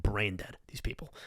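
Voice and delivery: whisper. Acted. Aside about video viewers.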